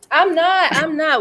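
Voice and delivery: high, loud mock protest; mock panic. Imitating herself panicking on the show. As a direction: yelp it out in mock alarm. Mocking her own panic.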